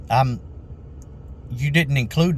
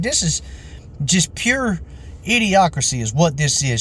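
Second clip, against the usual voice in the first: imitated indignation, starting high and coming down; mock outrage; 4 semitones higher than usual. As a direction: mimic a customer's disgusted verdict. Retold customer's outrage.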